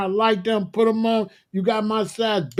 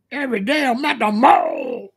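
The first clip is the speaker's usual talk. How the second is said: lightly voiced rapper line, higher; 5 semitones higher, 3 dB louder than usual. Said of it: "with that weirdo [name]".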